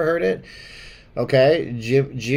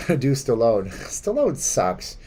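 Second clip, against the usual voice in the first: ordinary talk, nothing marked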